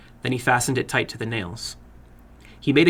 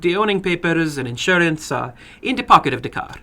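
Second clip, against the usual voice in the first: higher, eager voice of a little foreign man; a little man running his game with relish; 5 semitones higher than usual. Acted. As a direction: play the little man, keen and formal.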